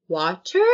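"Water?" as an over-articulated question; bad actor's improvised "Water?"; odd "Water?". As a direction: ask "Water?" with a rising, stilted delivery. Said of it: "he's a terrible actor".

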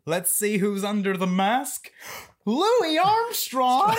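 building to a high, drawn-out reveal; hammed-up cartoon sleuth, slowing on the name; cartoon unmasking. Cartoon sleuth revealing who's under the mask, hammed-up high reveal.

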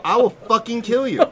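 mock fury, half amused; mad friend's threat. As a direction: imitate a furious friend laying down a threat. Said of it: "He is so mad".

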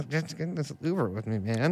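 low, soft creepy invite; creepy coaxing. Acted. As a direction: coax him in quietly. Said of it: "That's so scary".

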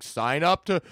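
a hint of a corporate ad voice; mock website sign-up pitch. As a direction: parody a pushy website asking you to sign up. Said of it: "it's just like".

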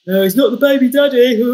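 drugged mother joking with the doctors; giddy joking shout. Retold or imitated. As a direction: crack the joke loud and high. Joking while on gas and air.

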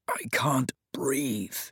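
slow, breathless whisper. Acted. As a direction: whisper it slowly, gasping for air. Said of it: "Leyland whispered".

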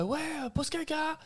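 very high taunting schoolkid voices; mocking kids. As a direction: jeer like nasty middle schoolers. Classmates yelling taunts.

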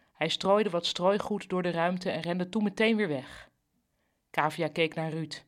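plain reading voice

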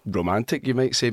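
ordinary talk, nothing marked

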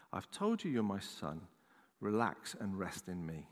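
low, soft, gentle voice of God; retold reassurance. The Lord calming his son at the roadside, retold reassurance.

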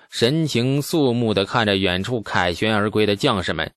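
usual speaking voice

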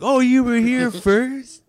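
high, slow sarcastic drawl; mocking. Comic mocking the heckler's claim, slow high sarcasm. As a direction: sneer back their own words. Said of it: "he's like".

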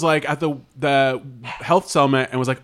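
ordinary delivery

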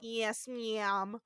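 imitated Midwestern accent with stretched vowels. Host repeats officer's one answer, mock Midwestern accent. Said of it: "has the best Midwestern accent".

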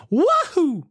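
high whoop of glee; excited whoop. Real, not acted. Host cheering about a night at the concert, high and whooping.

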